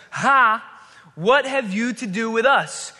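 demon's loud cry, read out dramatically; crying out loudly. A narrator's reading. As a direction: cry it out slowly and forcefully, as scripture narration. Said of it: "he cried out in a loud voice".

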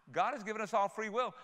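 ordinary talk, nothing marked

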